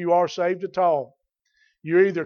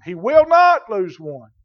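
"will not" pushed louder; emphatic promise; 2 semitones higher, 8 dB louder than usual. Real, not acted. Preacher assuring believers none is lost, emphatic stress on "will not". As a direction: lean hard on "will not".

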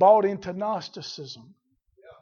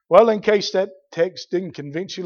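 normal talking voice

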